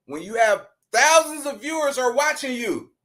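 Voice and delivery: usual speaking voice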